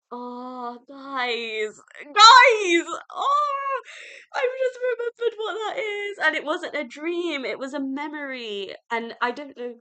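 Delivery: high, loud, laughing shout of sudden realisation; excited outburst. Real, not acted. Reader realising a dream is a memory, shouting excitedly to viewers. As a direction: yell it out as it all clicks into place.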